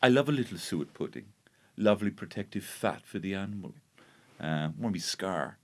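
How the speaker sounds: soft, low, creepily savouring voice; Hannibal Lecter. Acted. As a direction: quietly relish every word like a genteel cannibal. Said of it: "[name] goes full Hannibal Lecter".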